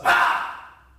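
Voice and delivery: sudden loud scream. Acted. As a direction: cry out as they grab her.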